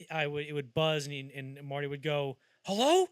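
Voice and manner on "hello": high, loud, startled "Hello?" as if just woken. Retold or imitated. [name] answering the door buzzer.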